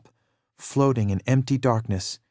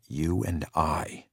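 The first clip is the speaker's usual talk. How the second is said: slow, soft and low with fatigue; weary; 6 semitones lower, 6 dB quieter, slower than usual. A narrator's reading. Walter to his brother, slow and worn out. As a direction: sigh the words out tired. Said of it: "he said wearily".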